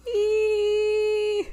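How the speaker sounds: high excited squeal. Real, not acted. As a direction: squeal with delight. Excited about haunted house.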